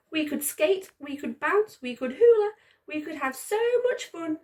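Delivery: high, wistful small voice; sad Simon. Acted. Lonely sock dreaming of fun with a pair, wistful. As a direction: dream aloud, a little sad. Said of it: "said Simon sadly".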